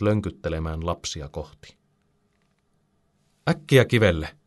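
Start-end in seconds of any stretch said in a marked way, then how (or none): 3.5-4.4 higher, restrained storybook shout; urgent shout. A narrator's reading. Alvar sends the children to the rock, urgent shout. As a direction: call out a quick order. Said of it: "Alvar huutaa".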